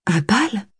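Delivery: wide-eyed little murmur; surprised murmur. A narrator's reading. Girl hearing of the ball, murmured in surprise. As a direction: breathe it out in wonder. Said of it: "murmura Antoinette en ouvrant de grands yeux".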